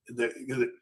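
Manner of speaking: ordinary delivery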